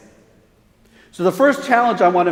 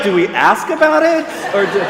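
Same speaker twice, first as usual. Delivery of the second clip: awkward guest; 4 semitones higher, 5 dB louder than usual. Retold or imitated. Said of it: "they're like".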